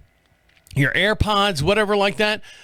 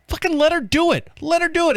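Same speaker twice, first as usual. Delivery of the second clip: higher, pushed hard, fired up; fired-up insistence; 9 semitones higher, faster than usual. Real, not acted. Host cheering that she be allowed to smoke, fired-up shout. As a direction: demand it like a fan cheering her on. Host ranting in favour of smoking.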